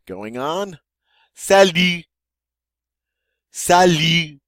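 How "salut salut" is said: "Salut" much higher and louder, falling; bright French model. Shown as a demonstration. Teacher modelling the greeting, high and loud. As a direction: call out "Salut" like a cheerful greeting. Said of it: "That's an informal expression".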